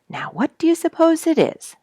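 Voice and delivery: high, bright-eyed wondering bird voice; lightly voiced curious bluebird. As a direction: wonder aloud as a little bird. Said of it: "said Mister Bluebird".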